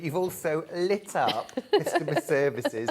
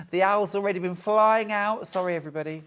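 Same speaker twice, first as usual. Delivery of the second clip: ordinary talk, nothing marked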